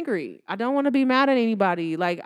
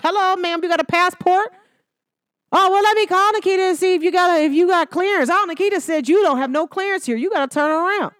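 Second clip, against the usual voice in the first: border patrol officer; 7 semitones higher, 4 dB louder than usual. Pretend. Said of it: "the border patrol don't stop you. Like".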